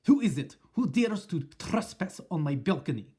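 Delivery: sharp, loud and menacing; witch voice. Acted. Witch outraged at a trespasser, sharp and loud.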